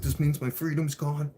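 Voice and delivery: retold frantic outburst; panicked despair. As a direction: spiral into panic. Anxiety freak out in court.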